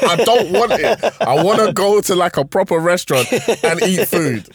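high, loud, laughing mock protest; acted childish protest. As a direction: plead like an exasperated kid, hammed up for laughs.

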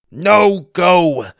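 loud, high commanding cry; urgent order. A narrator's reading. Trapped Wonka sending the kids away, loud order. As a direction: order them away at full voice. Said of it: "he ordered".

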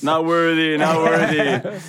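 slow chanted not worthy; bow down in mock praise; mock worship.

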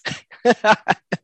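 a real burst of high laughter; loud laugh. Real, not acted. Laughing at rival fans.

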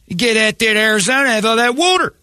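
very high-pitched mocking voice; hammed-up squeaky out-of-towner; mock Philly people. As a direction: send them up in a high, silly voice. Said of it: "those Philly people may come back".

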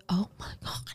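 breathless whisper; whispered awe. Retold or imitated. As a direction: gasp it in a hush. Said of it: "I was like".

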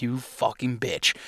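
slow, soft curse under the breath; muttered curse. Retold or imitated. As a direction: hiss it at the hen without being heard. Cursing the hen close by.

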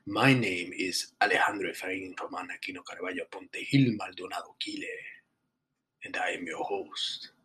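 mock Spanish accent. Host greeting his listeners in a mock Spanish accent. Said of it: "that is my real accent".

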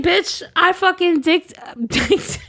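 loud, high gloating rant; mock yelling. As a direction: yell it in her face, laughing. Imagined confrontation yelled at Sasha.

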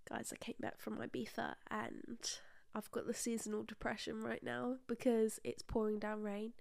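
soft, subdued host-style recap; quiet mock vlog opener; mock podcast intro. Shown as a demonstration. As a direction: play the podcaster segueing into her story. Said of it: "Now your turn".

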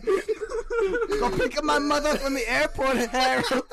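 high, slow whiny Bronx wife; nagging partner. Acted. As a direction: whine an order at your husband. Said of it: "old couple arguing in the Bronx".

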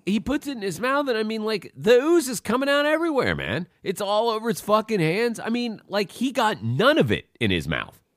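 high-pitched, worked-up disbelief; agitated, incredulous retelling; incredulous rant. Real, not acted. Worked-up rant about gross scene.

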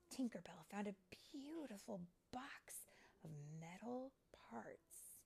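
usual speaking voice

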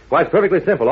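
ordinary talk

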